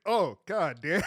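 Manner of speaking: loud, rising, amazed; impressed exclamation. Retold or imitated. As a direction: blurt it out, stunned. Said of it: "you're like".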